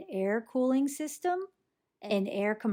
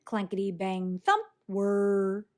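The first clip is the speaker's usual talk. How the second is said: ordinary delivery, nothing marked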